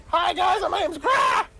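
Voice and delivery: high-pitched character scream; screamed introduction. Retold or imitated. Game character introduced to the party, screaming his name. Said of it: "he fucking screamed it every time he said his name".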